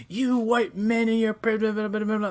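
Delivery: high, loud scolding voice; scolding woman. Retold or imitated. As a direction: nag him in a high pitch. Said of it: "she's like".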